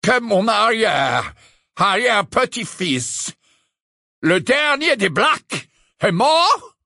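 put-on high, loud haughty old portrait; slow, lofty aristocrat; Phineas Nigellus, slow. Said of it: "dit lentement Phineas Nigellus".

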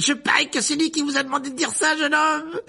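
high, loud jeering ghost; crying out. A narrator's reading. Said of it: "s'écria la tête de Sir Patrick".

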